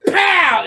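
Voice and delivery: high, loud shouted pow sounds; punch noises. Retold or imitated. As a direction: shout the punches like a cartoon fight. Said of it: "he's like".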